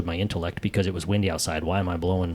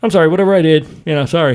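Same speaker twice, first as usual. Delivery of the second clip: 1 half-hearted apology; 8 semitones higher, 7 dB louder, faster than usual. Retold or imitated. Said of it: "kind of the halfway".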